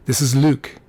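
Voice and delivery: put-on young Frenchman's voice; French accent. A narrator's reading. French caller gives his name, French accent. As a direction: introduce yourself with a French accent. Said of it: "in a heavy French accent".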